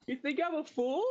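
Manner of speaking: very high-pitched character voice; Puck's indignant question. Acted. Puck answering Talon's parting words, high-pitched acted question. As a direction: squeak it out high, as a put-upon character. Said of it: "Puck just goes".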